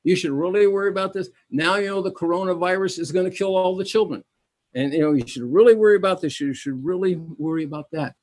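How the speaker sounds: high, loud alarmist newscaster; mocking the evening news, breathless alarm; mock news anchor. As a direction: hype up the fear like TV news. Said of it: "the first fifteen minutes is".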